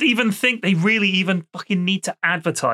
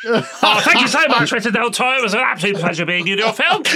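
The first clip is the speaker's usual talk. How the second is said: hammy luvvie actor; 4 semitones higher than usual. Pretend. Mock actor and director exchange.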